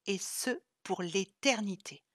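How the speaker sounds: plain reading voice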